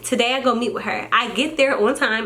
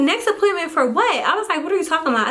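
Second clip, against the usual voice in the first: high incredulous "next appointment for what"; 6 semitones higher than usual. Retold or imitated. Scene: mom retells asking doctor why she came, incredulous high. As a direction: pitch it up in disbelief.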